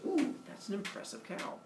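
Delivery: high, airy, awed voice; impressed ooh. Acted. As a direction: gasp it out, wide-eyed. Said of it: "And Minos goes".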